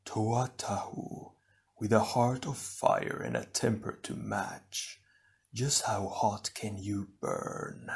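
slow, very soft, otherworldly sneer; an unseen evil lord mocking a hot-tempered hero; taunting shadow villain. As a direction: tease him from the darkness.

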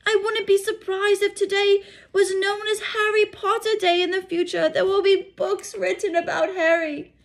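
high, loud, insistent witch; high, loud impassioned older woman; indignant McGonagall. Acted. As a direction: argue with rising passion. McGonagall voiced, arguing heatedly.

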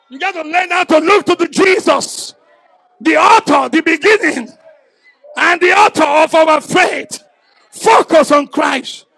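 loud, fervent preacher's cry; preaching at full force. Acted. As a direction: drive it home like a revival preacher.

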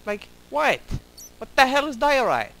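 high, loud, incredulous question; baffled outcry. Real, not acted. Player baffled by the block name, loud incredulous question. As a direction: blurt it out utterly confused.

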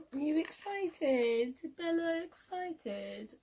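high, slow, cooing pet voice; talking to a dog. Real, not acted. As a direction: coo at the puppy softly. Talking to her dog Bella.

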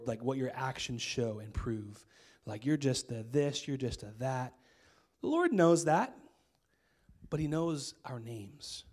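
usual speaking voice

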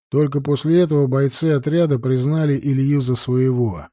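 ordinary delivery

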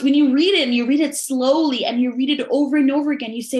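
ordinary talk, nothing marked

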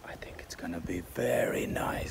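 normal talking voice

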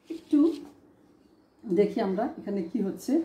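ordinary talk

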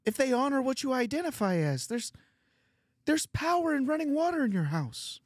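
high-pitched, strained Canadian Kermit voice; Jordan Peterson. Acted. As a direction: argue the case like a Canadian Kermit. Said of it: "in Jordan Peterson's voice".

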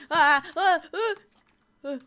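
short, loud, high yelps of fright; frightened screams. Real, not acted. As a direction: scream in quick startled bursts. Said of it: "and I'm scared".

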